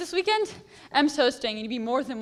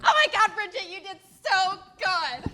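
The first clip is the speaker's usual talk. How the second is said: excited squeal; 7 semitones higher than usual. Acted. Friend squeals to Bridget after her rap, thrilled. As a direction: shriek it with delight.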